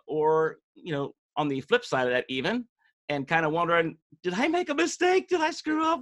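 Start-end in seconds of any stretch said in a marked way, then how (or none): 4.2-6.0 very high-pitched put-on fretting; exaggerated high voice of someone second-guessing themselves; mock self-doubter. Host voices a doubter's worried questions.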